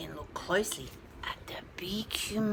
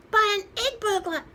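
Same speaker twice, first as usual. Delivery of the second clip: clucking chicken; 10 semitones higher, 7 dB louder, faster than usual. A narrator's reading. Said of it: "clucked the small chicken".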